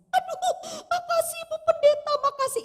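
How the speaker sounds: high-pitched gushing thanks; acted-out overwhelmed thanks in a high voice; grateful woman, imitated. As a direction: pitch way up, mimicking her gratitude.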